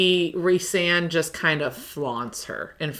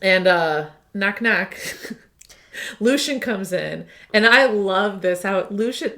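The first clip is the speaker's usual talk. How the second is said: ordinary talk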